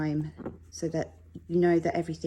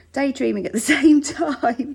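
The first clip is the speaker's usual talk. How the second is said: laughing mid-sentence, louder; 8 semitones higher, 9 dB louder than usual. Real, not acted. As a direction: giggle through the words. Laughs at own daydreaming.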